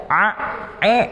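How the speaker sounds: "A, e" squeezed like straining; straining ain. Shown as a demonstration. Teacher shows the wrong, straining ain. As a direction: strain "A, e" as if pushing. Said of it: "itu jadi ngeden".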